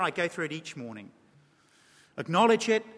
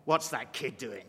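low, hushed disapproving aside; disapproving parent mutters about a kid; muttering onlooker; 8 semitones lower, 5 dB quieter, slower than usual. Acted. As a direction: grumble it under your breath like a judging stranger. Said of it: "acted disapproving onlooker".

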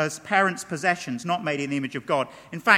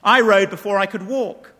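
louder, scornful outburst of a proud rancher; disgusted father; 2 semitones higher, 8 dB louder than usual. Retold or imitated. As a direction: bark it out in disgust at the crying boy. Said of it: "his father is disgusted and says".

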